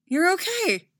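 high, loud, delighted relief; overjoyed to see his master. Acted. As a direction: burst out glad to see him. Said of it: "eyes widening in delight".

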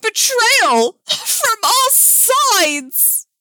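high, loud wail of betrayal; hammy anguish at full volume; gasped melodrama. Acted. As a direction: lament it hugely, drawing out each word. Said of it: "Dick gasped".

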